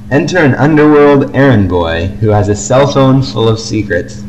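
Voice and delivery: usual speaking voice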